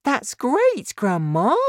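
put-on high, excited boy; delighted cry. A narrator's reading. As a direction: cheer her on in a high voice. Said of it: "George cried".

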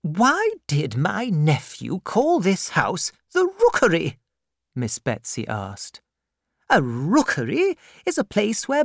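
a severe aunt demanding at the door; stern old aunt. Acted. Miss Betsy voiced.

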